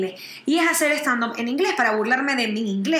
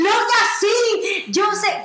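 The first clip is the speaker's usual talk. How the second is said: put-on playful agreeing girlfriend; 6 semitones higher, much slower than usual. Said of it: "tú y que".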